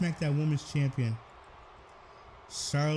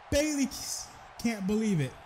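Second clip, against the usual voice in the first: surprised; 6 semitones higher than usual. Real, not acted. Commentator stunned by the kickout.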